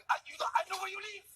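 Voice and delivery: very high mock threat; offended French meth addict. Offended addict threatening the comics, very high mock voice. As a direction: threaten in a tiny high caricature. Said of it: "now we've offended French meth addicts".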